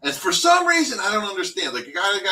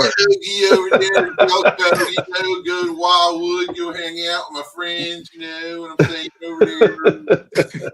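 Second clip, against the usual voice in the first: louder hammed-up Delco accent; mock Delco accent; 5 dB louder than usual. Joining in the joke with a mock Delco accent. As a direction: lay the Delco accent on thick. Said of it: "you got the different accents".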